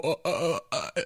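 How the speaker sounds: soft, halting awestruck stammer; a mocked dumbstruck gasp; mock stunned actor, trailing off quietly; stunned gaping mumble. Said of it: "shaking his head with his mouth open going".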